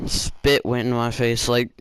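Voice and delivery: normal talking voice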